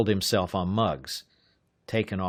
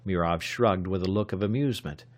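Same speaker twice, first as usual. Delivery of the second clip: usual speaking voice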